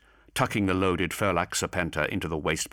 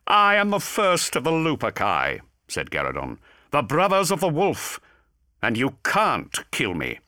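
higher, louder gloating voice; boasting demon; 9 semitones higher, 5 dB louder than usual. A narrator's reading. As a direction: boast with menace. Garadon boasting.

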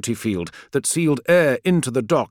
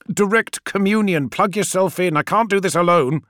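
raised, high-pitched orders; urgent command; 7 semitones higher, 3 dB louder than usual. A narrator's reading. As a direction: order your passenger to help, urgently. Pilot orders passenger urgently under fire.

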